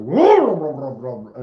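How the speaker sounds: loud whoa then engine rumble. Retold or imitated. Imitating hitting the gas.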